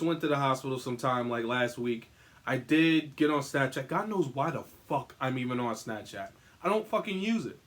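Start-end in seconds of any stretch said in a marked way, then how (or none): none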